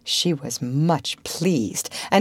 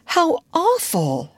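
high, drawn-out sympathy; slow, pitying exclamation; deeply sorry; 11 semitones higher, slower than usual. A narrator's reading. Wendy sees Peter's draggled shadow, very sorry.

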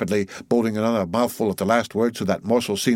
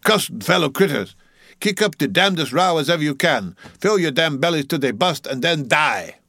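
put-on old cook's shrill, high dialect cry; 8 semitones higher, 3 dB louder than usual. A narrator's reading. Old cook blessing the mob of sharks, shrill cry. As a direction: cry out a cursing benediction, pitched high. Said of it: "raised his shrill voice and cried".

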